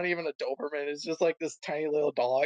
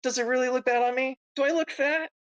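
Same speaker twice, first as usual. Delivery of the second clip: Crystal worried; 8 semitones higher than usual. Acted. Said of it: "Then back to Crystal".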